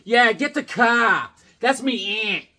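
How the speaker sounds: put-on a light Boston accent, slow and loud; mock the Boston guys; a light, hammed-up Boston accent mocking locals. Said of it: "do you hear those guys talk".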